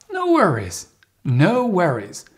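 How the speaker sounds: "No worries" starting high and swooping down; high pitch intonation. Shown as a demonstration. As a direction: pitch "No worries" up, friendly and eager to help. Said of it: "the higher, the better".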